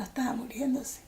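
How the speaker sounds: hushed, breaking whisper. Real, not acted. Recalling her dying brother on the phone, in a whisper. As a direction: barely voice it as grief takes over.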